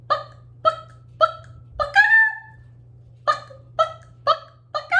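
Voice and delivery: very high, short staccato bucks; chicken clucking. Shown as a demonstration. Teacher playing the chicken sound, high staccato clucks. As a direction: cluck like a hen in tiny squawked beats. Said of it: "The chicken can say cluck or buck".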